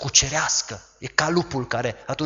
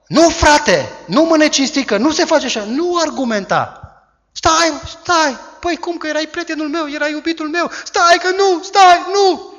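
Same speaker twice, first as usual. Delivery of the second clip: high, pleading, frightened protest; panicked refusal; 13 semitones higher, 5 dB louder than usual. Acted. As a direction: plead in panic to make him stop. Tamar voiced pleading refusal.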